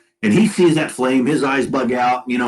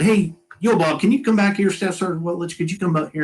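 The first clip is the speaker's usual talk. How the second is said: higher-pitched call to a crewmate; retold calling out; 7 semitones higher than usual. Airman summons Bob back to look, retold calling out. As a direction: lightly re-enact calling someone over.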